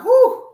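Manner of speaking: loud, very high "whew"; sharp high cry to shake off nerves; sudden burst of release after a scare; rattled whoop. Real, not acted. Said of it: "let me call down".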